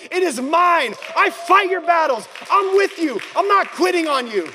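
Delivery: shouting back. Acted. God promising to fight the battle, shouting back. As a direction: proclaim it forcefully, pitched high. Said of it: "he begins to shout back to you".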